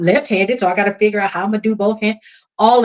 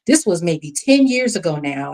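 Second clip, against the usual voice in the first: ordinary delivery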